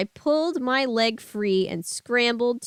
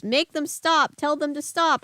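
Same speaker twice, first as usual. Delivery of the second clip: put-on overwhelmed boy begging; cried; 6 semitones higher than usual. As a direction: cry out to stop the noise. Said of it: "I cried".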